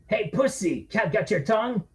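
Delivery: high jeering bully voice; sneering mockery; taunting drunk.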